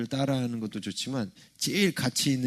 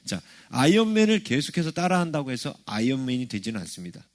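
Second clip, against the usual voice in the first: ordinary talk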